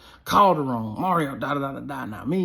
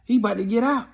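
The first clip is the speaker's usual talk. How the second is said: retold warning yell; 6 semitones higher than usual. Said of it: "teammates yell".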